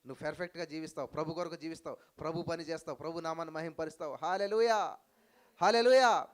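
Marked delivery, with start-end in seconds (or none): 4.2-6.3 loud, high hallelujah; shouted hallelujah. Real, not acted. Preacher rousing the congregation with a loud hallelujah shout. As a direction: belt it to the crowd.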